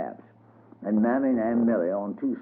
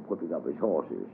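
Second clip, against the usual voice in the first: usual speaking voice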